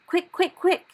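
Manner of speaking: high, loud urgent call. Acted. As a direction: hurry her up, shrill and loud. Giant urging Sophie to hurry.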